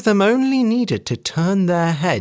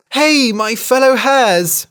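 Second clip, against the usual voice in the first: slow, loud, higher call to her kin; hare calling out; 9 semitones higher, 5 dB louder, slower than usual. A narrator's reading. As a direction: hail the other hares warmly. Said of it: "she said".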